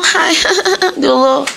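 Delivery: put-on high little girly hello. Mocking how some girls greet a date, high girly voice. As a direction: pitch it way up, girlish and put-on. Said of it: "put on a little girly voice".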